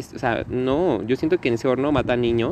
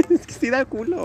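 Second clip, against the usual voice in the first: laughing; 13 semitones higher, 4 dB louder than usual. Real, not acted. Laughing while admitting fear.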